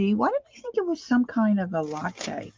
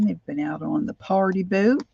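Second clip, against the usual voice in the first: usual speaking voice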